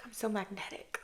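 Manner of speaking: soft unvoiced whisper, smug and private; whispered brag. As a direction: whisper the boast to yourself. Sassy inner thought, whispered.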